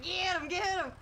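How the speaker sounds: high, loud replay of the yell; retold yell. Retelling her shout at the dogs, loud. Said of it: "I was yelling at the dogs".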